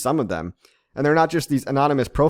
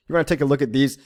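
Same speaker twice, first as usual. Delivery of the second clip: usual speaking voice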